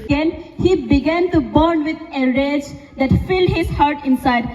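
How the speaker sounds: ordinary delivery, nothing marked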